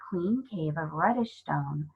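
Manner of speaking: ordinary delivery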